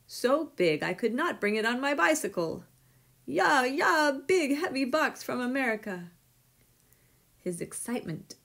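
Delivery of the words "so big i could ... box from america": high, loud and thrilled; excited shouting. A narrator's reading. Postman announcing a box too big for his bicycle, excited shouting. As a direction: call it out with mounting excitement. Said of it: "his excited shouts".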